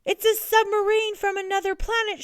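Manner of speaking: high, loud alarmed cry; panicked shriek. A narrator's reading. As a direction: shriek it like a startled coach. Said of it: "shrieked the coach".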